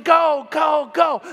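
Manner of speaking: loud, high chant of go; cheering shout. Retold or imitated. Players cheering Shay to first base, loud shout. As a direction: roar them on around the bases. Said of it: "They're going".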